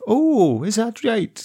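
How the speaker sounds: high, laughing mock English accent, a Scot putting it on; playful English accent with a laugh in it. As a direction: send up a Scot's slipping English accent with a laugh. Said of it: "Scottish, doing an English accent".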